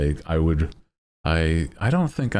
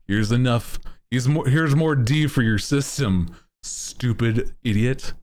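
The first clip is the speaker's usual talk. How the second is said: mock doctor; 6 semitones higher than usual. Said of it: "they gave me".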